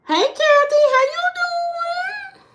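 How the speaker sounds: squeaky high hello; high-pitched greeting. Acted. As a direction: call out in a tiny high voice.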